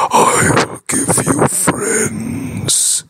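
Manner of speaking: hushed, whispered moon voice. Acted. The moon speaks to James.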